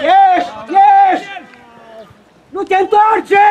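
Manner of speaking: loud, full-force coaching yell; urgent shouted orders. Real, not acted. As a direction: holler commands at players in mid-play. Coach calling orders to players. (strigăte de antrenor, cu putere)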